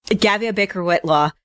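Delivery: ordinary talk, nothing marked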